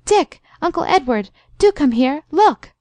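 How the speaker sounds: high, urgent exclamations; excited calling. A narrator's reading. Girl at the window summons the two men, calling excitedly. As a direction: summon them eagerly, pitch leaping up. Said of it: "called softly in great excitement".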